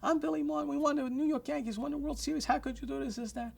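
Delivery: retold screaming. Billy Martin yelling at the restaurant owner, high shrieking rant. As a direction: squeal it like an outraged ballplayer. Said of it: "He started screaming".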